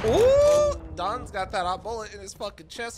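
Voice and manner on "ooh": sudden high, loud "Ooh!"; real thrill in a loud high yelp; excited whoop. Real, not acted. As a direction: burst out at the big play. Exciting kill in game.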